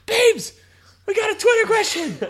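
very high squeaky mock voice; high-pitched teasing impression of Brock. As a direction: squeal it like an overeager friend. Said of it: "He's like".

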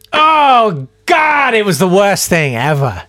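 loud, high groan of mortification; anguished self-reproach; cringing. Real, not acted. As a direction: wail at the embarrassing memory. Cringing at own prank memory.

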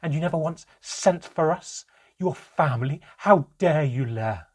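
loud, high-pitched angry outburst; indignant mother berating her partner at high volume; angry accusation. Acted. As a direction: hurl the accusation, outraged and loud. Mother's furious accusation of father.

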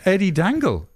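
high, loud awestruck gasping; star-struck fan meeting a legend; starstruck. Acted. Starstruck local meeting the hangman.

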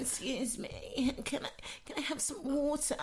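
seductive imitation. Mocking how her friend asks the waiter, mock-seductive. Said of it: "seducing them".

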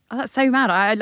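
usual speaking voice

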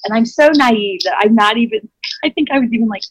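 ordinary talk, nothing marked

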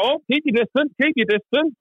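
high, jokey call to keep away; mock warning dad. Dad greets his son during lockdown with a high mock warning. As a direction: squeak it like an old man shooing you off.

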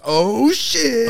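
high, long-drawn delighted exclamation; hyped greeting cry. Retold or imitated. Friend spotting Peter walk in, drawn-out hyped cry. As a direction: stretch it out like a hype man. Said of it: "every time he shows up".

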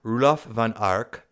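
name said like a German; German-sounding name. Shown as a demonstration. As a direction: Roelof van Ark with a German accent. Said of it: "I said that more like a German".

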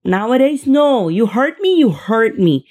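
normal talking voice

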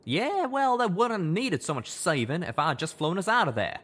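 high, loud, bitter self-reproach; he beats himself up; self-blame. Acted. Calamity blaming himself.

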